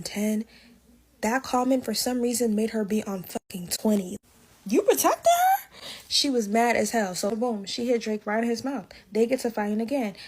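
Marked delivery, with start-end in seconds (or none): 4.7-5.8 very high, slow mock outrage; outraged Kalani. Kalani outraged that Drake defended Tyler, high slow mock disbelief. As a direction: imitate her disbelief, pitch way up.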